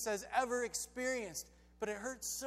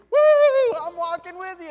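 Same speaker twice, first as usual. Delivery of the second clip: high, loud whoop into an eager cry; excited Peter; 9 semitones higher, 19 dB louder than usual. Acted. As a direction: whoop and call out, thrilled. Peter eagerly steps onto water.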